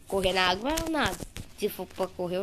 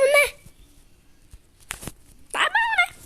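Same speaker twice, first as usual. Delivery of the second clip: very high squeaked falsetto, mock resigned; squeaky sarcasm; 6 dB louder, slower than usual. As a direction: jump way up into a silly falsetto. (falsete irônico e agudo)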